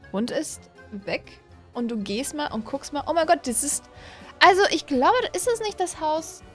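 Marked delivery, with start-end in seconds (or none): none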